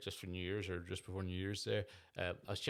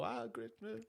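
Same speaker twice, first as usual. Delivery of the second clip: mock delight; 7 semitones higher, 4 dB quieter than usual. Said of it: "it make you go".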